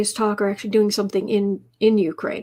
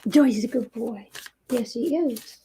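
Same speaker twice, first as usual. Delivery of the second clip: pet praise. Real, not acted. Owner coos praise at her cat Joy. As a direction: coo it fondly at the cat.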